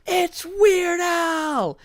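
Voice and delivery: high, drawn-out crowd shout; shrieked celebrity sighting; excited yell. Retold or imitated. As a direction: yell it across a room, stretching the words. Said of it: "somebody yells".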